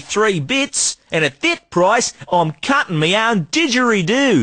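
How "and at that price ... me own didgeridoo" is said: hammed-up Aussie salesman twang; broad Australian accent. Street trader hawking junk in a broad Australian accent. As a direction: lay on the outback Australian accent thick. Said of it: "we only talk like that when there's foreigners about".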